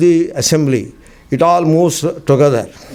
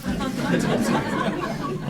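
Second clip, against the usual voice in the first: soft laugh; 10 dB quieter than usual. Real, not acted. Laughing at being dismissed.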